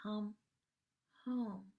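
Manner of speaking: hushed, breathy Come home; soft voice of love. Pretend. The voice of love calling the listener home, hushed. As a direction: call them home in a near whisper. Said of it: "that voice saying".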